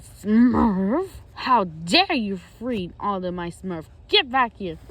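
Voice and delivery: loud, slow villain outrage; furious acted shout; Gargamel. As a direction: roar it, dragging out the words. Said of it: "and he said".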